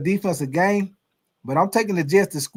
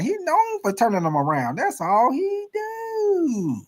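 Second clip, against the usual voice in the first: starts near normal, ends much higher and very slow; mocking critics; 9 semitones higher, slower than usual. Host mimics how critics will talk about Carroll, mocking squeal. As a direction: stretch the last words into a mocking squeal. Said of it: "that's how they gonna say about Pete Carroll".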